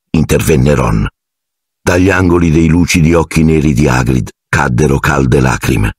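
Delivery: plain reading voice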